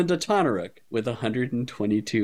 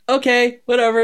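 high, louder airy okay-whatever; ditzy brush-off; 13 semitones higher, 6 dB louder than usual. Retold or imitated. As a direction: shrug it off like a careless airhead. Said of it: "acting super ditzy".

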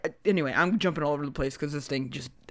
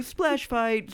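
ordinary talk, nothing marked

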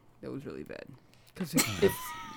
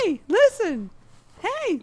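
higher and louder, a pestering fairy call; Navi impression; 9 dB louder, slower than usual. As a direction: nag like the Ocarina fairy. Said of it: "Navi from Ocarina of Time".

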